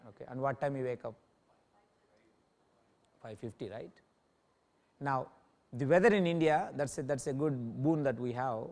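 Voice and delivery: normal talking voice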